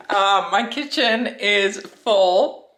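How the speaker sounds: ordinary talk, nothing marked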